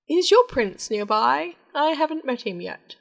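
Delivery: curious king voice. Acted. King asks about prince.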